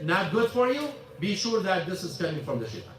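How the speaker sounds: normal talking voice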